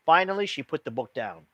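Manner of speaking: usual speaking voice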